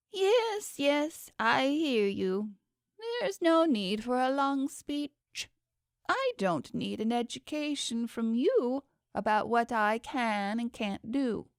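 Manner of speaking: a proud god cutting the speech short; impatient underworld god. A narrator's reading.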